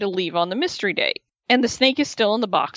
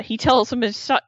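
ordinary talk

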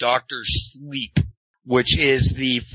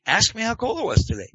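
higher and pushier on the repeat, stress on "it"; insistent repeat; 9 semitones higher than usual. Shown as a demonstration. Host pressing cohost to ask about the weather, insistent. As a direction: ask again, pressing the point.

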